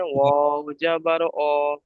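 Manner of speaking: slow, drawn-out spelling; deliberate syllable-by-syllable model; spelling demonstration. Shown as a demonstration. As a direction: hold each vowel long for the learner to copy. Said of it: "প্রথমে বানান করে নেন".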